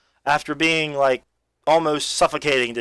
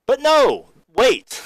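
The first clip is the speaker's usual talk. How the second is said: dramatic twist; 11 semitones higher, 5 dB louder, much slower than usual. Real, not acted. Sudden twist in story.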